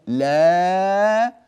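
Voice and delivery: "لا" held long and loud on the alif; long drawn la. Shown as a demonstration. Teacher showing students the long alif sound in "لا". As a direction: stretch "لا" out slowly. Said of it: "فنأتي بصوت الألف هنا".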